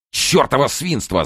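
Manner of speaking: high-pitched exasperated outburst; angry yell. A narrator's reading. Kern cursing his useless cellmate, angry yell. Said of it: "заорал Керн".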